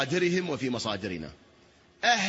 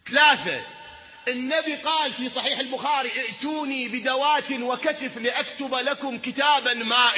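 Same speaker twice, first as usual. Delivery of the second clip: high, loud, drawn-out pulpit cry; fervent preaching; 8 semitones higher, 6 dB louder, slower than usual. Acted. Preacher warning the congregation about heresy, fervent shout.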